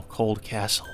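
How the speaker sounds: usual speaking voice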